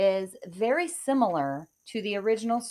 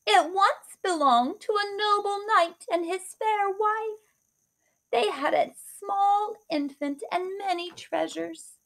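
put-on old woman; 6 semitones higher than usual. Old woman tells Kate of the giant, high kindly voice. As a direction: tell the sad tale gently as a grandmother.

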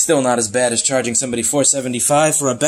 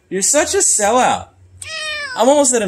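higher mock-accusing tease of "sellout"; higher playful jab at the cat; higher teasing; 12 semitones higher than usual. Real, not acted. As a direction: lift it up and needle the cat.

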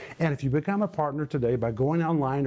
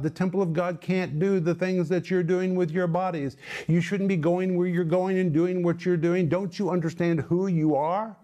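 higher, forceful preaching voice; preaching Paul's rebuke; 3 semitones higher than usual. Acted. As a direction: preach it at them with conviction.

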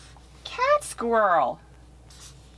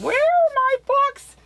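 very high, loud squeaky squirrel; 5 dB louder than usual. Acted. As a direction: squeak it out like a frantic little squirrel. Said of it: "So she's going".

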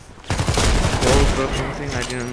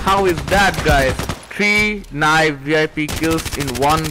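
proud boast; 2 semitones higher, 4 dB louder, slower than usual. Real, not acted. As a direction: show off to the viewers. Bragging to viewers.

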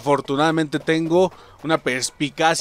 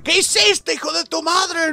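much higher, mock angry scolding; a hammed-up telling-off, pitched way up; imagined scolding; 13 semitones higher than usual. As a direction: fling it like a furious parent catching a kid. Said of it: "me cae la voladora ahorita de".

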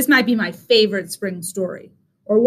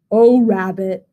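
yawning bear; 3 semitones lower, 4 dB louder, slower than usual. A narrator's reading. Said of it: "said Bear with a yawn".

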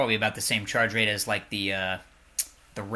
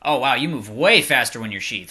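usual speaking voice

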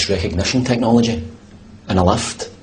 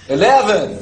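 higher, louder fake American that sounds Irish; mock American accent; 8 semitones higher, 3 dB louder than usual. A Scot trying an American accent at a lift. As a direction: overdo a Yank accent. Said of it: "Where in America is that? Dublin?".

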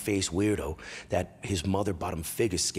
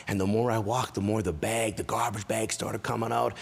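ordinary talk

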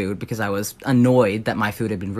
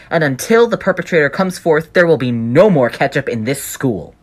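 stern announcement; 4 semitones higher, 7 dB louder than usual. Retold or imitated. Vice principal's intercom announcement.

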